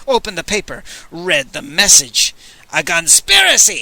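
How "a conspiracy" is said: pitched-up furious yell. Acted. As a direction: shout it out in outrage. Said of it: "he yelled".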